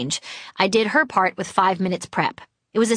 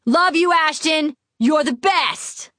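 high, slow, gushing yell; crazy fan shout; 7 semitones higher, slower than usual. Retold or imitated. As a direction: shout it like a starstruck superfan. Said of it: "loudly and sincerely shouted like a crazy fan".

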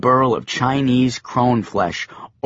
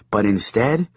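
plain reading voice

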